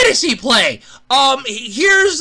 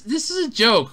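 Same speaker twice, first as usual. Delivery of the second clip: ordinary delivery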